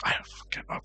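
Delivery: breathless, voiceless disbelief; hushed stunned swear; whispered aside. Retold or imitated. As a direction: drop to a hush as if too shocked to speak. Stunned aside while quoting himself.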